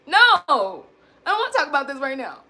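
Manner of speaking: sharp loud refusal; the blunt way she says she need not use. Shown as a demonstration. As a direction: shut the talk down hard. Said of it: "I don't have to say it like that".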